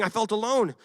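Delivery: mild mock lament. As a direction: complain you're the last one left. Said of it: "Elisha was saying".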